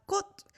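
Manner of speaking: "kot" closed off on a T; final T sound. Shown as a demonstration. Teacher explaining the changed sound, "kot" ending in T. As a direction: say "kot" with the last consonant as T. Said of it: "it sounds more like a T".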